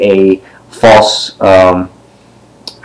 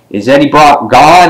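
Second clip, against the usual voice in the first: ordinary delivery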